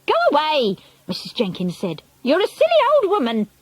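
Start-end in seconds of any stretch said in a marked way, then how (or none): 0.0-0.8 high, affronted dismissal; indignant wife. A narrator's reading. Wife sends the grandmother away, indignant. 2.2-3.5 very high, affronted scolding; indignant wife. A narrator's reading. Wife insults the grandmother, indignant.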